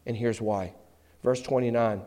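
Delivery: ordinary talk, nothing marked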